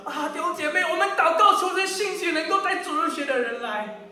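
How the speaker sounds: high, eager mock prayer; mock pious plea. Preacher mimics members praying for someone else to teach, mock pious.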